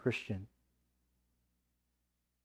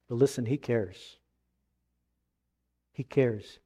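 reassuring; 3 semitones lower, 5 dB quieter than usual. Real, not acted. Reassuring the congregation.

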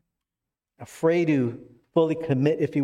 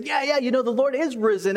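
excited chatter; 9 semitones higher than usual. Retold or imitated. Disciples excitedly retelling resurrection news.

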